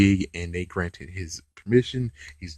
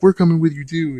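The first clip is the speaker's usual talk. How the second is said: loud, high group call; rally together eagerly; team shout; 8 semitones higher, 8 dB louder, faster than usual. Retold or imitated. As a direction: Rangers calling out to Brody in a loud, eager voice. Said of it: "the others are like".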